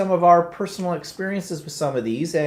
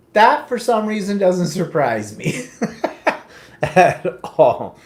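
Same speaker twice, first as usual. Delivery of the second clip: amused laugh; 4 semitones higher, 5 dB louder, slower than usual. Real, not acted. Laughing at Tracy's confession.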